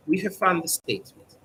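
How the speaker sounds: usual speaking voice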